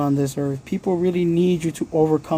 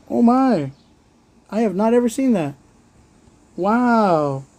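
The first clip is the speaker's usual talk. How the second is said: breathy amazed higher voice; awed wonder; 6 semitones higher than usual. Real, not acted. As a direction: marvel at something you've never seen. Watching the bird in wonder.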